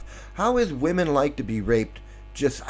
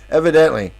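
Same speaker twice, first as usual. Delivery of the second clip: ordinary talk, nothing marked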